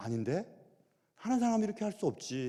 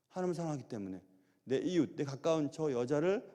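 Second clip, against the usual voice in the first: normal talking voice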